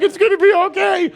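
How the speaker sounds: very high-pitched mock wail; imitated hysterical crying. Father comforting his daughter in the dentist's chair, mock-hysterical crying. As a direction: reassure through exaggerated, high-pitched crying. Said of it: "I'm, like, hysterical crying".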